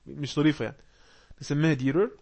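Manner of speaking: ordinary talk, nothing marked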